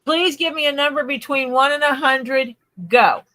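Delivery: high, loud game-show call; excited host call. Real, not acted. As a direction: announce it to the crowd. Calling game start to chat.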